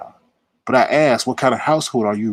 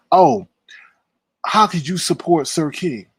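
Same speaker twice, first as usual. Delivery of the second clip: mocking outcry; 5 semitones higher than usual.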